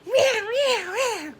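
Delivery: very high, loud laughing meows; screeching cat. Retold or imitated. Her fight with the boss, screeched as high cat meows. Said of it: "That's what it sounded like".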